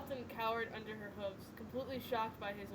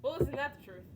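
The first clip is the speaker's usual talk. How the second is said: sarcastic; 4 semitones higher, 8 dB louder than usual. Real, not acted. Listener's sarcastic remark.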